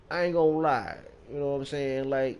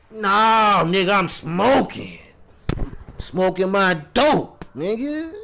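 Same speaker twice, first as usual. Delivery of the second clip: higher, loud and drawn-out correction; loud mock rebuttal; 6 semitones higher, 5 dB louder, slower than usual. Smoker correcting people who assume he sniffs, loud protest. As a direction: protest it loudly, stretching the key words. Acted rebuttal to imagined questioners.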